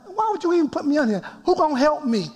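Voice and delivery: high-pitched, retold protest; upset plea to God. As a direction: plead upward as if upset with God. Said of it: "and I'm really upset".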